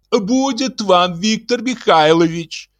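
high, slow call from a window. A narrator's reading. Elena calls from her window to calm the locksmith. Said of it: "крикнула из окна".

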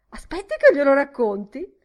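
high, loud, insistent plea; eager begging. A narrator's reading. Miss Deuce begging her friend to listen, high loud pleading. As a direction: beg hard to tell the story. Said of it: "supplicò Miss Deuce".

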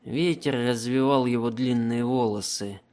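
ordinary delivery

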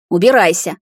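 forceful growled command; growled order. A narrator's reading. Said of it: "зарычала она".

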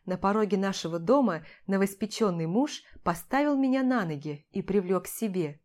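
usual speaking voice

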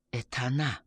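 soft, hushed murmur; low-volume, hushed realisation; whispered. A narrator's reading. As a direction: whisper it in dread-free understatement. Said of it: "прошептал Ингвар".

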